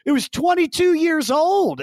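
loud, very high distraught protest; hammed-up mother's wail; mock wailing. Mother pleading for the old cat, mock wail. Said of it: "my mom calls me weeping".

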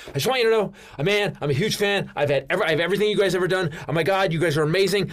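high, hurried starstruck praise; gush, tumbling over your words; gushing fan. Retold or imitated. Huge fan walking up to the [name] backstage, gushing. Retold gushing to a rock star.

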